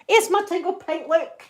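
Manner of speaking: high-pitched eager hail; excited calling out. Real, not acted. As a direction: call them over to look, voice lifted. Calls viewer to show work.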